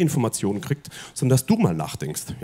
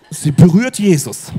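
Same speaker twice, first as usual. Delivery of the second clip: ordinary talk, nothing marked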